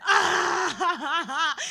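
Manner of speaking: loud sobbing wail; a hammed-up crying wail on the street; mock wail. Sobbing on Madison Avenue.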